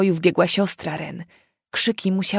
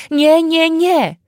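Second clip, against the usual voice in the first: high, loud desperate no; panicked screaming; 10 semitones higher, 7 dB louder, much slower than usual. Acted. Terrified girl screams no, high and loud. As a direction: scream no in terror.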